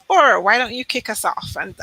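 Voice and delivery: normal talking voice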